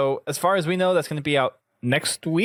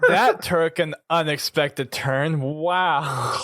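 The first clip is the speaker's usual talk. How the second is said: slow, words breaking into laughter; laughing; 3 semitones higher, much slower than usual. Real, not acted. Host to the panel after the joke, laughing slowly. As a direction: laugh through the comment.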